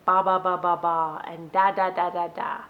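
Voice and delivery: babbled ba and da syllables, slowed like an infant; babbling demo. Shown as a demonstration. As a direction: imitate a baby babbling ba and da. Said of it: "They start babbling".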